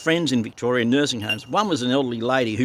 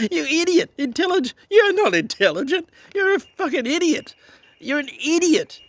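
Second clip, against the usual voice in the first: high mocking scorn. Real, not acted. Ranting at commissioner.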